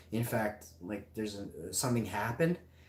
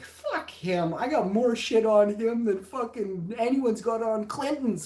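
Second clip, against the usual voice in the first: defiant boast; 9 semitones higher, 5 dB louder than usual. Retold or imitated.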